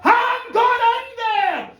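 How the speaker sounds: a high, loud, slow battle cry; wild shout. Retold or imitated. Connor deciding to jump over the rock, a high wild shout. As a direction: throw yourself in with a yell. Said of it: "he thought".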